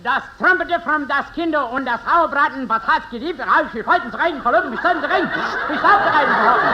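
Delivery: laughing fake German gibberish; hammed-up German film director; mock German accent. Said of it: "the last picture you made in Germany".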